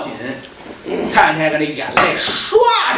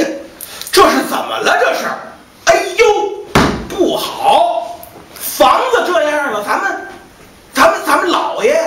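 higher, urgent servants calling for the master; anxious calling out; 8 semitones higher than usual. Acted. Servants search the wrecked room, anxious high calls. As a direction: call out for the master, alarmed.

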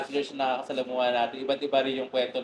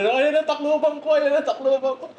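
high excited yell of triumph; elated cheering shout; shouting in joy; 11 semitones higher, 4 dB louder than usual. Retold or imitated. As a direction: shout it out like you just won. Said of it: "nagsisigaw na rin".

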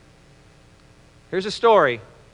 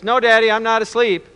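put-on higher-pitched child's answer; lightly acted kid voice, pitched up; little boy; 4 semitones higher than usual. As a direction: answer Dad like a small boy in bed. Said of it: "And he goes".

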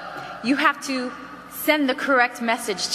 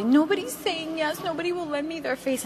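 higher, lightly whiny mimicry; mock a discouraged trainee complaining to the director; complaining consultant; 5 semitones higher, 4 dB quieter than usual. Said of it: "I've had consultants call me and say".

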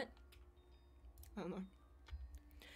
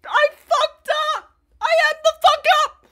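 very loud, very high yelling; self-blaming scream; 16 dB louder than usual. Real, not acted. Streamer answers a friend's question, self-blaming scream. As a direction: scream the confession at full pitch. Answers how they messed up.

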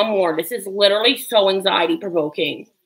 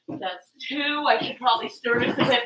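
normal talking voice

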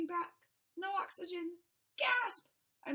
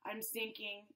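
"sinking" low and soft, fading; 7 semitones lower, 7 dB quieter than usual. Acted. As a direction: let "I'm sinking" drop low and soft. Fish sinks, said twice.

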